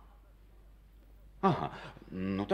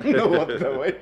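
louder laughing agreement; 6 semitones higher, 11 dB louder than usual. Acted. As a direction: laugh it off, pleased. Man laughs at woman's concession. (со смехом, довольно)

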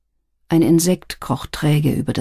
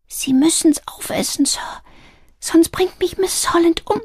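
put-on high, small girl's voice, begging; pleading little girl; 12 semitones higher than usual. A narrator's reading. Adelaide begs sir to eat up, pleading. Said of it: "bat Adelaide".

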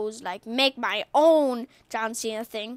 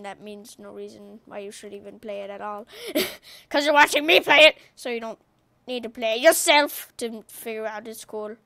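ordinary talk, nothing marked

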